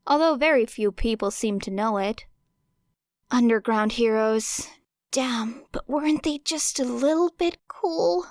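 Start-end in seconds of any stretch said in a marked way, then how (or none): 5.1-8.3 higher, teasing voice in her head; a tempting little voice needling her; nagging inner voice. A narrator's reading. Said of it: "That voice again, nagging".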